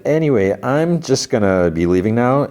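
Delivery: high, singsong excuse to leave; retold awkward exit. Intruder backing away from shopkeeper, mock-casual high voice. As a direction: edge out with a high, careful "Anyway".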